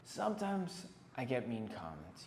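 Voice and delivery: ordinary talk, nothing marked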